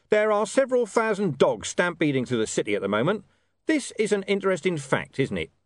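high-pitched, humourless watch commander; sardonic Vimes. A narrator's reading. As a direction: announce it with menacing mock interest.

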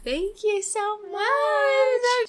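put-on high, slow, loud drunken gushing. Drunk friends flattering the bartender, high slow gush. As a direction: coo it like a tipsy clubgoer at the bar. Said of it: "They're probably just drunk".

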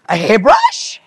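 baffled guess. Retold or imitated. Retelling herself guessing at the cleaning tool, baffled. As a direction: squeak up in disbelief.

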